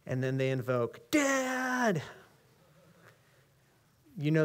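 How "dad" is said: a hint of a child crying out for dad, pitched up; child calling dad. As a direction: call out like a hurt kid. Child calling father in story.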